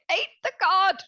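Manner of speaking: alarmed outburst. Acted. Repeats it aloud when questioned.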